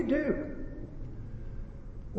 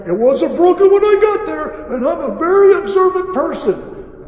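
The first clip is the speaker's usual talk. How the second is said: raised, high, loud protest; indignant customer; 12 semitones higher, 10 dB louder than usual. Retold or imitated. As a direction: mimic an irate customer protesting. Acting out irate customer's retort.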